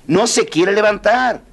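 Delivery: high, slow, stretched cry for help; mock panicked shout. Servants yelling to the merchant, high slow panicked call. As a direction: call out helplessly, high and drawn out. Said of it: "le gritaron al mercader".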